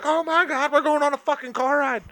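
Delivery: high, thrilled dog voice; excited dog voice. Pretend. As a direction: speak as the overjoyed dog. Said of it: "he'll be like".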